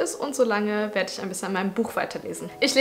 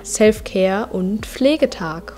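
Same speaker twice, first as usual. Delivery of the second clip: louder, even vlog voiceover; voiceover narration; 6 dB louder than usual. Acted. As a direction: narrate the day's recap clearly. Recorded voiceover recap. (Voiceover-Erzählung)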